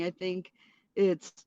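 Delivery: ordinary delivery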